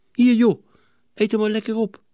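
put-on boy kindly offering the monkey its banana; friendly. A narrator's reading. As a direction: coax the frightened monkey kindly. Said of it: "zei hij vriendelijk".

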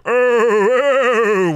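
loud high ghostly wail, oh oh; banshee wail. Acted. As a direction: let out a wailing Marley moan. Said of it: "just going".

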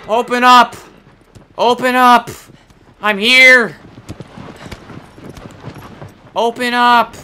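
loud, high playful yell; calling out. Pretend. Gamer playfully demands entry, loud high shouting. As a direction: holler to be let in. Calls out at a game door.

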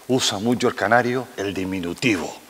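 ordinary talk

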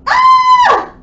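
long, very high, loud scream; sustained full-volume shriek on "ah". Shown as a demonstration. Teacher acts out the command to scream, piercing high scream.

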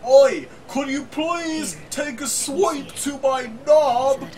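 high, loud, slow mock Cockney. Propositioning the phone listener, hammed-up Cockney. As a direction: beg bawdily in awful Cockney. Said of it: "He was like".

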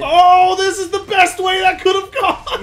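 loud, high delighted exclamation; high, loud gamer excitement; elated shout. Real, not acted. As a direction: cry out in gleeful disbelief. Delighted by a gameplay mishap.

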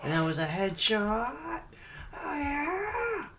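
loud, very high-pitched crowing; triumphant celebration. Real, not acted. Gamer celebrating a headshot, triumphant squeal. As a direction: rise into a squealed cheer.

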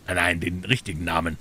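usual speaking voice

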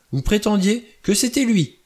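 high, indignant accusation; crying out. A narrator's reading. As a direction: accuse them at the top of your pitch. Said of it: "s'écria Vera".

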